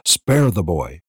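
usual speaking voice

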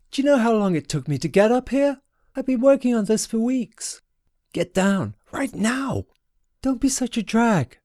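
put-on high, carefree girl's voice; teasing girl calling. Sewell in the air teases her brother below, high and carefree.

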